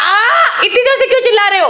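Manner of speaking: high-pitched squeal of protest; playful outcry. Pretend. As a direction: exclaim high and fast at the noisy child. Child screeches, mother cries back.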